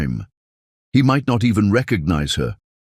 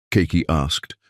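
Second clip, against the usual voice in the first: plain reading voice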